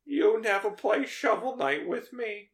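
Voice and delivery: high-pitched complaining mother; Tony's mom. Acted. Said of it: "that's, that's his mom".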